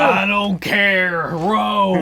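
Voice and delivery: slow, loud, firm order; stern command. Acted. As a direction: bark at him to keep rowing. Character snaps back.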